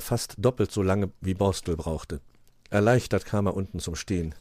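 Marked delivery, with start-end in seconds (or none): none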